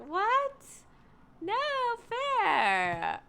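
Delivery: normal talking voice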